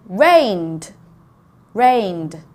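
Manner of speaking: RAINED drawn out, then repeated, closing on D; teacher isolating the D ending of rained; D sound at the end. Shown as a demonstration. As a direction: say rained as one syllable with a voiced D. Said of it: "rained is the D sound at the end".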